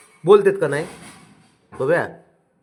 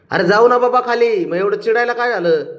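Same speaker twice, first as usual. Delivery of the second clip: higher and louder, pleading; exasperated coaxing; 6 semitones higher, 5 dB louder than usual. Real, not acted. Owner urging the cross cat to come down, louder and higher. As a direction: raise the voice to win the cat over.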